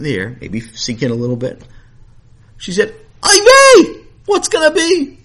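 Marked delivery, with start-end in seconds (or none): 3.2-5.2 high, loud Yiddish-style dismay; alarmed cry. Acted. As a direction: throw up your voice in shock. Mother sees son floating.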